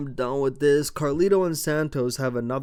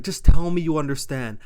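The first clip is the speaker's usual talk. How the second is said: loud, higher-pitched demand; Nick Aldis asserting authority; 4 semitones higher, 11 dB louder than usual. Retold or imitated. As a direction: issue a forceful order, imitating a boss. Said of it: "Nick Aldis, like".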